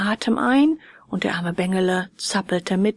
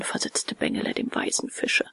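plain reading voice

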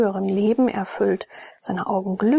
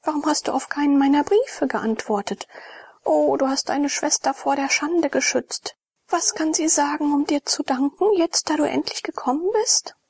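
higher, eager sister's voice; joyfully greeting the long-awaited brother; joyful Ione; 7 semitones higher than usual. A narrator's reading. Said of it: "sagte Ione freudig". (Ione begrüßt freudig den Bruder, höher und eifrig)

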